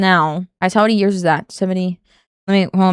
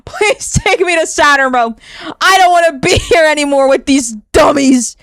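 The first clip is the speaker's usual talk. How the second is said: higher, whining mock plea; exasperated pleading; 6 semitones higher, 4 dB louder than usual. Real, not acted. Streamer begging to flee Earth, exasperated whine. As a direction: beg dramatically to be taken away.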